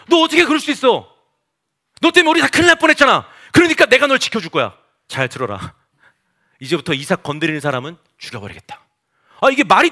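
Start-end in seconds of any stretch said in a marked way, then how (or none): 0.1-4.8 high-pitched, loud royal scolding; exasperated, raised rebuke; indignant king. Acted. Re-acting the king's scolding. 5.1-8.8 lower, softer royal warning; low, measured threat; king's stern decree. Acted. King issues a threatening decree.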